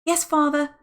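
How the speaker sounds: high loud call back; loud high girl's shout; shouted reply. A narrator's reading. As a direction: shout back to her father. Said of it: "shouted back George".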